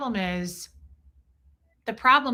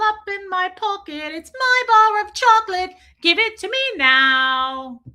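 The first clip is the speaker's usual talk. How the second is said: slow, rising greedy demand growing louder; spoiled child; 6 semitones higher, 5 dB louder, slower than usual. Retold or imitated. Marilyn demanding the whole world from Aaron, slow greedy brat voice. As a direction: savour every word like a greedy brat. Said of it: "she's like".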